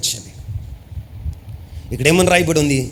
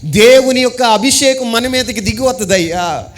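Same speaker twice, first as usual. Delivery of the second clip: fervent preacher voice, raised pitch; preaching; 7 semitones higher than usual. Acted. Preacher exhorting the congregation, fervent. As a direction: preach it out to the church.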